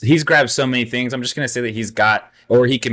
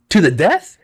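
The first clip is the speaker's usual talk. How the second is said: high, louder eager question; Vizzini voice; 7 semitones higher, 6 dB louder than usual. Acted. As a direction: lean in with glee.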